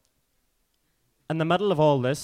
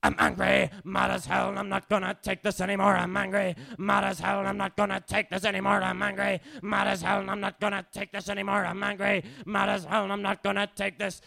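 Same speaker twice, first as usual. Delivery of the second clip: angry, driving spoken-word chant; spoken-word performance; 7 semitones higher than usual. Acted. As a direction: pound it out as a rhythmic refrain. Performs spoken-word refrain on stage.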